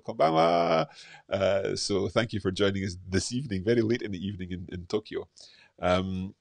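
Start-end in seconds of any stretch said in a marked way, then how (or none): 0.1-0.9 echoed greeting. Acted. Echoing viewer's Japanese greeting.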